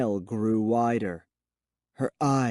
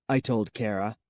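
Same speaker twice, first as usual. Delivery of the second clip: usual speaking voice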